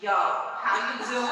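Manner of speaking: loud, laughing New York "Yo, how you doing?"; New York accent. Retold or imitated. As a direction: ham up a New York greeting. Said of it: "New York accent".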